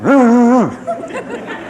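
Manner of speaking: loud high mock growl; imitated stinger. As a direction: growl a long wordless comeback. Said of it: "and he went".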